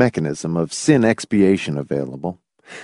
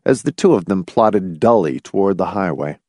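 ordinary delivery

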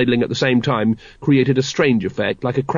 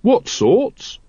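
high, rising puzzled question; mildly indignant young recruit; protesting; 10 semitones higher than usual. A narrator's reading. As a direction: protest with a higher, questioning lift. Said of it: "protested Carrot".

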